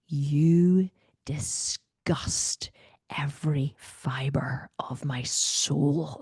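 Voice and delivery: very slow, drawn-out, whispered loathing; dramatic disgust. Retold or imitated. Wife trying to shame husband, slow and dramatic. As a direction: deliver it like a grand curse. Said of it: "I really put a lot of power behind it".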